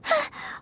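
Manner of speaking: quick breathless intake of air; startled little gasp; sharp startled gasp. Acted. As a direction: gasp as a sudden noise startles you. Paimon startled by a sound.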